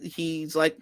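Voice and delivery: ordinary delivery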